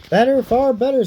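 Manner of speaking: a fish bargaining to be freed; pike voice. Acted. The pike urging Ivan to throw it back, high. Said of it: "says the pike".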